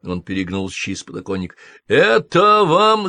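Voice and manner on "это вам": slow, higher, louder call down; shout. A narrator's reading. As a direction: yell it down from the window. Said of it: "закричал он вниз". (громкий крик вниз из окна)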